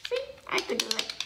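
ordinary delivery, nothing marked

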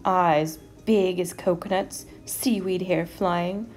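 ordinary delivery

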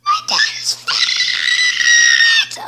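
very high, slow shrieking scream; a girl screaming at the top of her voice. Acted. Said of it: "You screaming girl".

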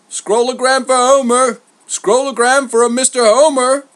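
high, loud crier's shout over a crowd; calling out. A narrator's reading. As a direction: holler it like a messenger hunting the addressee. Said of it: "he called out to the crowd".

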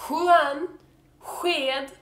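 "Juan" and "sked" with an open, airy hw start; Juan-like sj-sound. Shown as a demonstration. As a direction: demonstrate Juan, sked with the same breathy h-w sound. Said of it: "it's still the Juan pronunciation".

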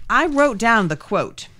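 ordinary talk, nothing marked

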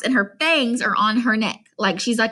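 ordinary delivery

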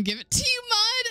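high, squealed through laughter; cracking up. Real, not acted. Player tickled by a mod, laughing squeal.

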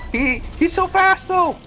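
high, loud panicked outburst; panicked gaming. Real, not acted. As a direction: plead for help in a high, frantic yell. Panicking at a fast boss.

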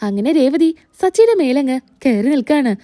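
usual speaking voice

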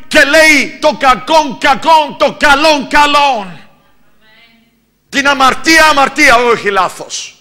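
high, loud, pounding sermon; fiery preaching. Acted. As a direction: preach it hard to the congregation. Preacher at full force. (φλογερό κήρυγμα)

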